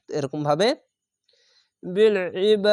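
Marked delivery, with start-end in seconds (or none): 1.8-2.7 বিল ইবাদ ending with a bouncing দ; বিল ইবাদ with qalqala on দ; qalqala stop. Shown as a demonstration. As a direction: echo the দ of ইবাদ as you stop. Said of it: "কলকলা করে থেমে যাবেন".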